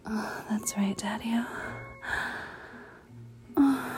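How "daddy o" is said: ordinary talk, nothing marked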